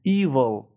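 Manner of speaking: "Evil" with a long, stretched EE at the start; EEE-vol, teacher's model of "Evil"; drawn-out first vowel. Shown as a demonstration.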